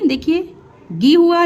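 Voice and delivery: ordinary talk, nothing marked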